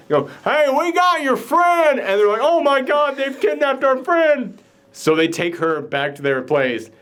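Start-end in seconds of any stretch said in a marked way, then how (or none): 0.4-2.0 high, drawn-out hillbilly holler; hollered call. Retold or imitated. Tucker and Dale call to the college kids, high drawn-out holler. 2.4-4.6 high-pitched, hammed-up alarm; mock panic. College kids think their friend is kidnapped, high mock panic.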